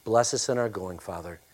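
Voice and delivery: usual speaking voice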